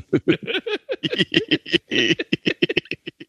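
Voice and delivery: high-pitched burst of laughter. Real, not acted. After the Bigfoot bit.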